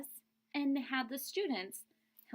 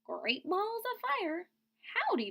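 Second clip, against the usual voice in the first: a newborn gosling hollering hello; high baby goose; baby goose greeting; 11 semitones higher than usual. Acted. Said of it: "looked up at Jack and said".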